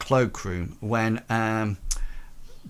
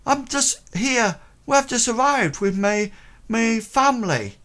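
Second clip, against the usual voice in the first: put-on high young boy explaining; boy's voice; 12 semitones higher, 3 dB louder than usual. Boy explaining himself to them.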